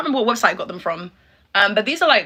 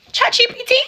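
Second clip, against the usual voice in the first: much higher, louder incredulous squeak; mocking squeak; 5 dB louder than usual. Shown as a demonstration. Mocking the ex's line as written by a chatbot, squeaked high. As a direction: squeak it in mock disbelief. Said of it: "Where did you get that line from?".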